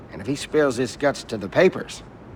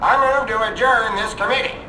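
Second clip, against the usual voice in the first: high, loud call for order over the room; urgent calling out; 10 semitones higher, 3 dB louder than usual. Acted. Senator shouting over the hearing, urgent and loud. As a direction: cut through the uproar to adjourn.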